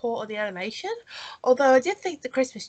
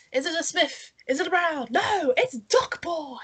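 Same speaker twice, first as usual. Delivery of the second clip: superhero announcer; 7 semitones higher than usual. Pretend. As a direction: build up the big hero reveal. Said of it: "sounds like a superhero".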